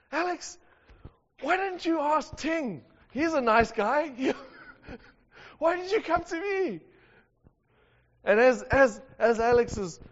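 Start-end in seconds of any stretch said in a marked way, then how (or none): 0.1-6.8 half-asleep and fed up, sending him elsewhere; groggy disbelief at a 3 a.m. visitor; exasperated. Retold or imitated. Woken at 3 a.m. by friend.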